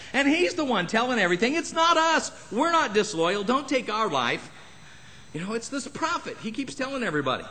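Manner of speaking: mock pleading, pitched high; a terrified servant begging for his life. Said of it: "down on his knees going".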